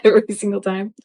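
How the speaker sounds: normal talking voice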